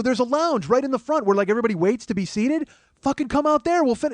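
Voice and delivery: high, loud crowd voices urging; crowd shout. Retold or imitated. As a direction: egg him on like the audience. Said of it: "They go".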